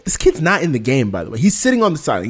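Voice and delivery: normal talking voice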